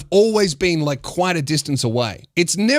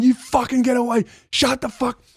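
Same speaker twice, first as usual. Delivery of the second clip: higher-pitched mock yell, held back in volume; retold yell; 6 semitones higher than usual. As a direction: act out yelling someone off. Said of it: "as soon as I yelled at him".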